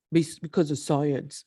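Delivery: lightly mimicked lame justification; mild sneering put-on voice; mocking excuse. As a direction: parrot the excuse dismissively. Mimics an excuse she rejects.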